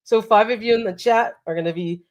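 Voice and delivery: usual speaking voice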